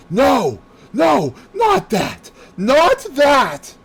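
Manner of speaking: high, loud, slow pleading cry; terrified cry. Acted. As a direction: beg in panic, stretching each no. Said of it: "with a sudden cry ... she cried out".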